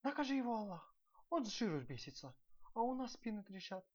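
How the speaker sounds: high-voiced servant's complaint, not truly whispered; whispered grumbling. A narrator's reading. Overworked servant grumbling about the prince, high-pitched complaint. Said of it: "шепнул один".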